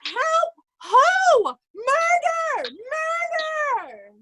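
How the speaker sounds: high shrieks of murder, crying out; terrified screaming. Acted. Oswald being beaten by Kent, terrified crying screams. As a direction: scream as the blows land.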